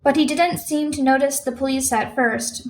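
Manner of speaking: ordinary delivery, nothing marked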